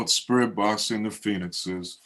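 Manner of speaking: ordinary talk, nothing marked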